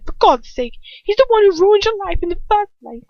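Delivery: put-on frustrated boy arguing; exasperated Ron. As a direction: throw the accusation at her. Ron argues in disbelief.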